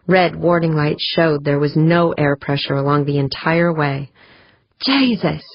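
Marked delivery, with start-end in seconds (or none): 4.8-5.4 high, startled exclamation; shocked yelp. A narrator's reading. George on pushing the hatch open, startled high yelp. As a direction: yelp it, pitch jumping up. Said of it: "George yelped".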